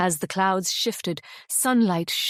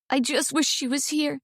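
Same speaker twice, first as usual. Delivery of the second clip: a touch of a shaky, higher voice; holding back tears, lightly; 5 semitones higher than usual. A narrator's reading. Iris to Rory about her mom, mildly shaky. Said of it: "she said in a shaky voice".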